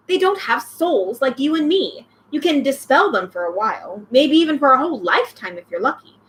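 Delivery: ordinary delivery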